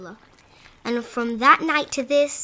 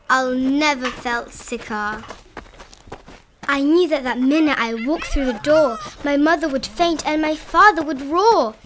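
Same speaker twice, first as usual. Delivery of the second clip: ordinary delivery, nothing marked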